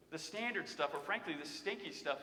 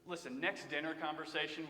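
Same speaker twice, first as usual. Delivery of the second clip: ordinary talk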